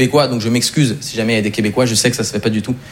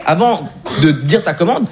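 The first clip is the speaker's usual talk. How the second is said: ordinary talk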